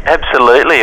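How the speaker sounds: usual speaking voice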